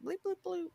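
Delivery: high, soft bleep-bloop imitation; bleeping instrument noises. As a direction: mimic a little instrument riff in nonsense syllables. Imitates a musical part for musicians.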